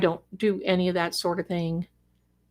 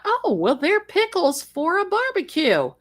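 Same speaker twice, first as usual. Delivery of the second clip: naive inner thought; 10 semitones higher than usual. Retold or imitated. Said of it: "I thought".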